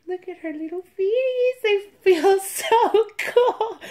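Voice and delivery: high, delighted cooing squeal; excited gushing. Real, not acted. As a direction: squeal over something adorable. Gushing over the doll's feet.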